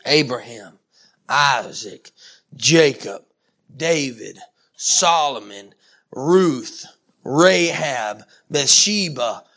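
low, measured preaching, name by name; solemn roll call. Real, not acted. Preacher listing saints at heaven's feast, solemn roll call. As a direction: give each name its own weighty beat.

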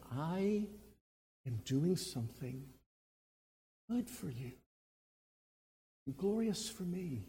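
soft, gentle, reassuring; tender Jesus voice. Acted. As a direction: speak it softly as comfort.

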